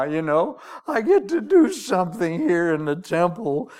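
high, laughing, delighted; old priest thrilled to get his turn; gleeful old man. Retold or imitated. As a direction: cackle with joy at finally winning. Old priest overjoyed at winning.

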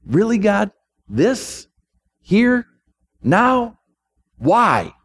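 high, rising disbelief in separate one-word questions; incredulous questioning. Acted. Weary wheat asking God about its value, incredulous.

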